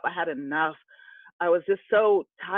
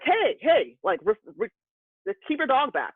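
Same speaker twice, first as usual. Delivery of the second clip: startled, high-pitched calling out, retold; startled man; 6 semitones higher, 5 dB louder, slower than usual. Man startled by a dog running out, startled protest. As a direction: imitate a startled man raising his voice. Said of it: "got startled".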